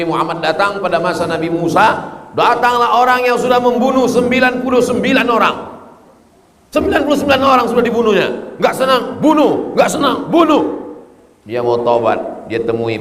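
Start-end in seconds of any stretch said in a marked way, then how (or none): 2.3-10.6 high, fast, intense telling; dramatic storytelling. Acted. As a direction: build menace in the killer's tale.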